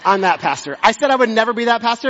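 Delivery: usual speaking voice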